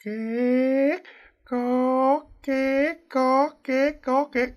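high-pitched slow creaking, evenly spaced; drawn-out squeaky creaks; imitated creaking hammock. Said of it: "cái mình nghe cà có".

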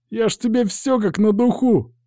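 high-pitched whimpering lad; plaintive, high-voiced hooligan; whining. A narrator's reading. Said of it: "захныкал Сарай".

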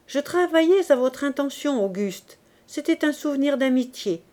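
a hint of a proud sergeant; lightly voiced policeman. Poisson answers Lantier. (le sergent de ville répond à Lantier, voix plus aiguë)

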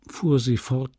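plain reading voice

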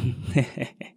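soft sinister chuckle; creepy chuckle at a captive; haunting laugh. A narrator's reading. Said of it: "Một giọng cười đầy ám ảnh vang lên".

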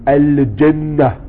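slow al-jannah, lam clearly sounded; al-jannah said slowly. Shown as a demonstration. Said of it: "le lam, il se prononce". (al-jannah lentement, le lam bien prononcé)

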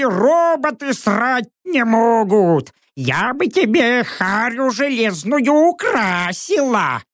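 high, drawn-out witchy scolding; spiteful high-pitched owl voice; cursing owl. A narrator's reading. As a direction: berate him as an angry bird. Angry owl berates the narrator.

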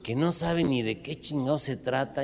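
ordinary talk, nothing marked

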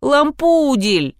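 high, drawn-out wheedling call; whining. A narrator's reading. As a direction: whine the name like a pleading boy. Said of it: "заныл из коридора Кирюша". (ноет, жалобно тянет)

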